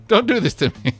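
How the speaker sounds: normal talking voice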